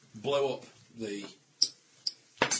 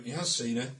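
ordinary talk, nothing marked

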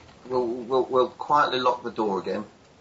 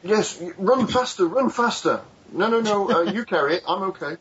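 high, louder comic order-giver; urgent instructions; 8 semitones higher, 4 dB louder than usual. Acted. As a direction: urge others on while staying out of it. Character directs firefighters while backing away.

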